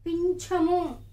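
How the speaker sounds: పింఛము stretched out slowly; slow clear teacher model; slow model word. Shown as a demonstration. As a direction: draw out పింఛము for children to copy. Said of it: "నెమలి పింఛము అంటాం".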